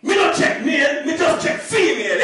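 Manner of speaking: loud Jamaican patois yell; a furious Jamaican dad complaining he wasn't invited; Jamaican dad shouting. Retold or imitated. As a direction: bark it at him like an angry Jamaican dad.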